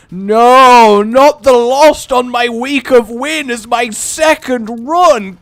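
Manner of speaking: loud, high cry of despair; anguished outcry. Real, not acted. Game goes wrong, cries out.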